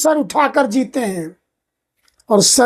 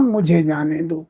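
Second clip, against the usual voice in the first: lower, softer echo of मुझे जाने दो; fading echo; 7 semitones lower, 5 dB quieter than usual. Acted. The wife's soul repeats her plea to the husband, softer and lower. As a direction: let मुझे जाने दो die away softly.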